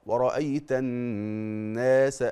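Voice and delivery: الناس with the alif held two counts; careful two-count الناس; slow recited madd. Shown as a demonstration. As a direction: draw out the alif of الناس gently. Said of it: "لما تقرأ".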